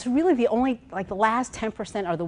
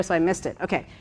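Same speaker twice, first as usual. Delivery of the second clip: ordinary talk, nothing marked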